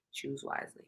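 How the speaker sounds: soft whisper, dropped low; whispered warning. Acted. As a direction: breathe it as an ominous hint. Ending advice dramatically.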